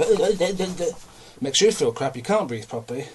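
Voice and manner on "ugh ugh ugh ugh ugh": higher, louder bouncing grunts; jolted grunts. Retold or imitated. Forklift over potholes, belly jolting grunts. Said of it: "That makes your belly go".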